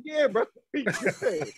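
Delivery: slow, laughing through it; laughing reassurance. Retold or imitated. To teammate Phil, he's not mad: slow laughing reassurance. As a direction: laugh it off with him, easy and slow.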